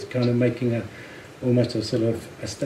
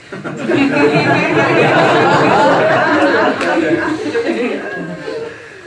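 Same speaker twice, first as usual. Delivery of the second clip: long high breathy laughing fit; loud, high, airless laugh; 13 semitones higher, 5 dB louder than usual. Real, not acted. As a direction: burst out laughing helplessly. Long laugh at own story.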